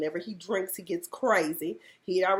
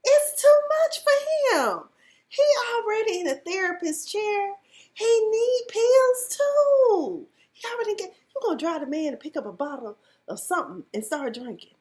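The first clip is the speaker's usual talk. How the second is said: dramatic lament for Jeff; outraged plea; 11 semitones higher, 5 dB louder than usual. Real, not acted. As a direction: cry out on Jeff's behalf.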